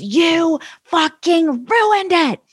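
high, drawn-out mock yell; retold scream. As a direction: imitate a boss yelling, slow and high. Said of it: "screaming at me".

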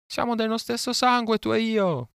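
vulture's call. A narrator's reading. Captive boy signals a passing vulture from the treetops, high calling cry. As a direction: call out like a bird, high and sustained. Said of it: "lanciare il grido di richiamo degli avvoltoi".

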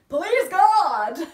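loud, high, panicked cry for help; desperate plea. Retold or imitated. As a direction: beg the heavens mid-canter. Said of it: "I was like".

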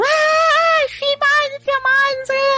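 high, hammed-up screaming aunt; mock scream. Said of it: "a tia, ela gritando".

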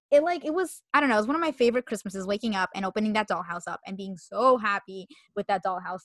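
ordinary talk

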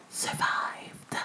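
hushed, unvoiced single word; dramatic whisper. Real, not acted. Podcaster revealing the last wife's fate, dramatic whisper.

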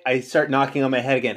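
ordinary talk, nothing marked

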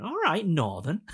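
high, teasing mock greeting; stranger's greeting. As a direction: imitate a passer-by hailing a Northerner. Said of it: "this guy turns around to her".

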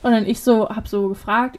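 ordinary talk, nothing marked

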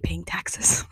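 whispered aside. Real, not acted. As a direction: drop to a breathy whisper on the punchline. Punchline about bitter taxpayers.

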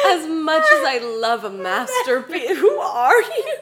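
quoting through high laughter; giggling while teasing; laughing. Real, not acted. Teasingly quotes her friend, laughing.

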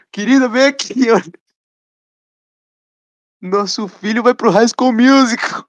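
high, amused imitation of a father calling his wife; hammed-up proud dad, slow and high; mock dad voice. Joking host imitates friend's father calling his wife, mock proud. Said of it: "olhando ele e falando".

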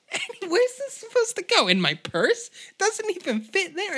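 squeaky Sally squealing in disbelief; squealing waitress. Acted. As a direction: shriek it in disbelief. Sally squeals about size.

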